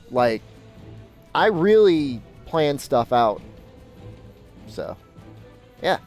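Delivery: ordinary delivery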